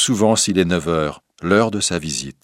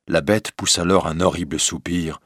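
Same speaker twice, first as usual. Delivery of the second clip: plain reading voice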